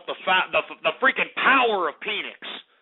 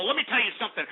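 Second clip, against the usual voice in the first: ordinary talk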